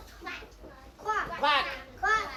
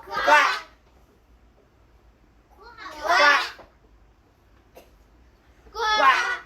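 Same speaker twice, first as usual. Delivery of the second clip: loud quack; 9 semitones higher, 3 dB louder, slower than usual. Shown as a demonstration.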